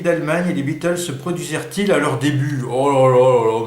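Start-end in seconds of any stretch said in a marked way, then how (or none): 2.7-3.6 loud, overwhelmed groan of dismay; real dismay. Real, not acted. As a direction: cry out, stumped by the question. Stumped by a quiz question.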